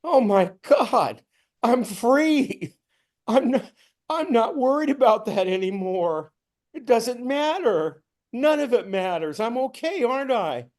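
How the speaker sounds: elated wonder; joyful disbelief. Retold or imitated. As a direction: marvel at being free. Realizing he is free.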